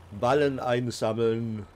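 ordinary talk

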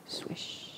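breathy basketball swish sound; whispered swish. Pretend. Coach joking about his basketball shot, whispered swish.

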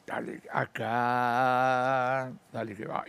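mock-dreamy entrepreneur voicing his hunch; impulsive entrepreneur. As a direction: drawl out the gut impulse. Voices entrepreneur's impulsive spark.

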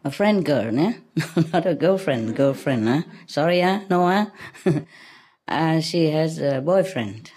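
ordinary talk, nothing marked

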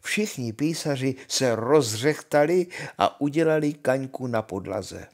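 plain reading voice

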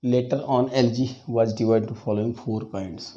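slow, quiet dictation for note-taking. Acted. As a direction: read it out slowly so students can write. Dictates notes to class. (Notes लिखवाते हुए धीमी आवाज़)

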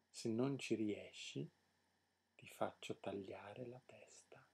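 slow, sinking into a menacing whisper; slow right down and drop to a whisper; quiet, drawn-out royal threat; hushed threat. A narrator's reading. King threatens to behead the prince.

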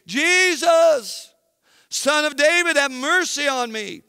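calling out. A narrator's reading. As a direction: call out like a blind beggar pleading. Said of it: "he called out, saying".

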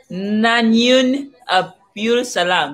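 slow, drawn-out learner's try; carefully testing an unfamiliar phrase; careful pronunciation attempt. Real, not acted. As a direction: stretch out "Nanyun" as you sound it out. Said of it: "Did I say that right?".

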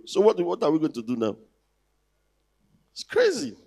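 ordinary talk, nothing marked

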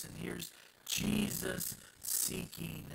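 ordinary talk, nothing marked

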